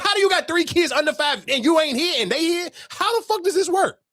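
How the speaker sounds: high-pitched baffled challenge; exasperated, incredulous questioning; indignant disbelief. Retold or imitated. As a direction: demand an explanation, voice climbing. Imagined challenge to absent father.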